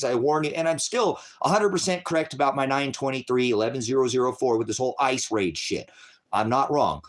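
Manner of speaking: ordinary delivery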